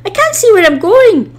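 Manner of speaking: high, loud panicked wail; runaway engine racing backwards in panic. A narrator's reading. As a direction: shriek it out in terror. Whizzing backwards downhill.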